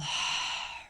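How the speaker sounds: long audible exhale through the mouth; demonstrated sigh of release; big releasing sigh. Shown as a demonstration. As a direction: let all the air go in one relaxing sigh. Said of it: "release the air with a big sigh".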